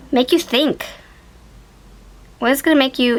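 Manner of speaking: ordinary delivery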